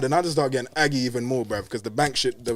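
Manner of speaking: ordinary delivery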